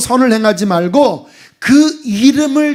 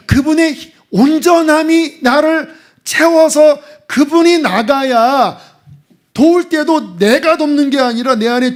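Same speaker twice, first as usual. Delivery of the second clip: impassioned sermon climax; fervent preaching; 5 semitones higher than usual. Acted. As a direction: lift the congregation with rising conviction. Preaching rises.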